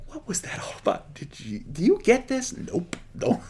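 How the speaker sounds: hushed, confused whisper between friends; whispering disciples. Retold or imitated. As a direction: lean in and whisper like puzzled followers. Disciples whispering to each other, confused.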